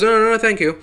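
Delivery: lightly voiced nervous [name]; nervous refusal. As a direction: play a panicked man politely declining. [name] refusing visor again.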